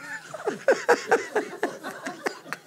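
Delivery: shrill laugh. Real, not acted. Laughing at her own fury.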